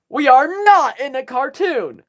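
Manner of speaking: high, loud, slow insistence; mock exasperated. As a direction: protest it emphatically, imitating an exasperated character. Acted argument from the show.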